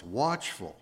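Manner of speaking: ordinary delivery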